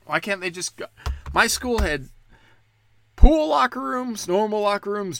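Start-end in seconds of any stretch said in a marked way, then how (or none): 0.0-0.9 loud rant. Real, not acted. Ranting about locker rooms. 3.2-5.2 loud listing. Real, not acted. Listing locker rooms, raised voice.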